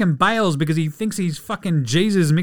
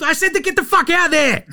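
high, loud cut-off yell; mock shout; 12 semitones higher, 5 dB louder than usual. KD cutting Kyrie off with a high hammed-up yell. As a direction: bark it over him, way up high.

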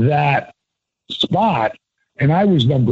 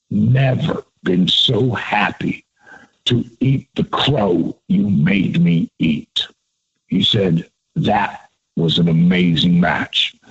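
lower, slow, sincere veteran's praise, imitated; Michael Hayes voice; 7 semitones lower than usual. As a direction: lower and slower, imitating a veteran being straight with you. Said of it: "could do a dead-on Michael Hayes".

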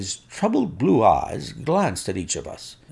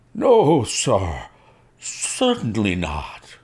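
a nervous servant blurting a denial; frightened servant denial; 3 dB louder, much slower than usual. A narrator's reading. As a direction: caught out and scared, protesting. Accused servant denies, face ghastly.

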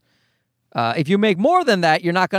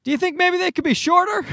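father asking, higher; 11 semitones higher than usual. Retold or imitated. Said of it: "And then he said".

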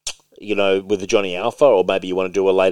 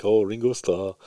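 put-on light Scouse accent on a quick quip; Liverpudlian accent; 5 dB quieter than usual. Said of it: "a Liverpudlian accent".

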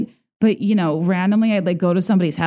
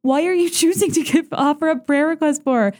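high, incredulous mock reaction; mock shocked whisper-talk; 8 semitones higher than usual. Chapter members reacting in disbelief to the announcement, high mock incredulity. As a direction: play the horrified onlookers. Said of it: "And we're all like".

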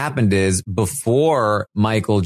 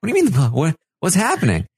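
high, stumbling confused questions; mock bewilderment; 7 semitones higher, faster than usual. Ally asks what is happening, bewildered. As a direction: act out being totally lost, pitch jumping up.

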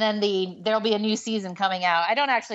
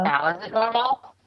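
muffled and garbled; speaking through a bad mic; garbled audio. Real, not acted. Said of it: "it's still kinda garbled".

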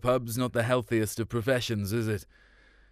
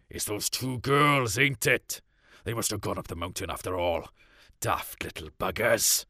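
cussing dad; 2 semitones lower than usual. A narrator's reading. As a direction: grumble it like a cross father. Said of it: "He carried on cussing".

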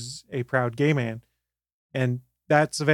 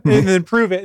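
usual speaking voice

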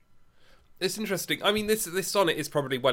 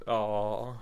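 ordinary delivery

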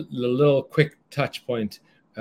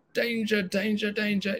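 high, urgent alarm guard; barking alarm; 8 semitones higher than usual. Acted. Cousin Adrenaline sounds the alarm, barking. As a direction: bark the warning like a siren. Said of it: "barking instructions".

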